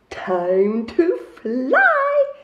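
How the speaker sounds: slow, loud squeak of a mouse; squeaky little mouse. A narrator's reading. Story reader voicing Pip taking off. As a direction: stretch it out like a tiny mouse. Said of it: "he squeaked".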